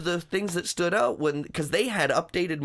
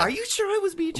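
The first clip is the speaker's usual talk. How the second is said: mock disbelief; faster than usual. Mock doubt about his old role.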